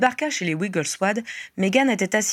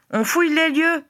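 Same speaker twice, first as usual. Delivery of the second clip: high, raised-voice reply; calling back; 9 semitones higher, 3 dB louder than usual. A narrator's reading. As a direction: call the answer across to someone out of sight. Harry répond à l'appel. (réponse lancée d'une voix haute)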